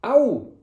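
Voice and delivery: loud, high "ow"; sharp high yelp of pain; cry of pain. A narrator's reading. Servant being shaken roughly, cry of pain. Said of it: "rief Leor".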